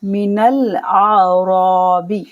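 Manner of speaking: slow chanted verse; slow recitation. Shown as a demonstration. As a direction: recite it drawn out like tilawat. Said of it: "नून को लाम के साथ मिला देंगे".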